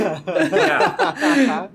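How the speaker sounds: high laughing yeah. Real, not acted. Still laughing.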